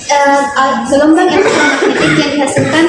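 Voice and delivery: ordinary talk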